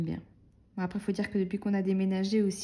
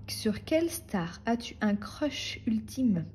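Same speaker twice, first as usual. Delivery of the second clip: slow reading; 3 semitones higher, much slower than usual. Acted. Reading the next question aloud.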